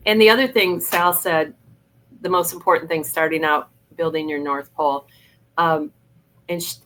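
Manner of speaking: ordinary delivery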